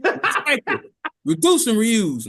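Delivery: hammed-up finger-wagging; mock lecture. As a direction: scold like an eco poster. Alien lectures about littering.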